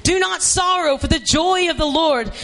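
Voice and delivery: shouted high, do not sorrow; preaching shout. Acted. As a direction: call it out to the crowd. Shouted repeat.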